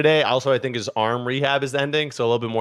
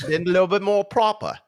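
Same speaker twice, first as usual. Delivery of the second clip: high, mock-proper British voice; old-time proper British accent; 7 semitones higher than usual. Co-host correcting the accent to London, mock proper British accent. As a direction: put on an antique, proper English accent. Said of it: "He's saying the accent's from a hundred years ago".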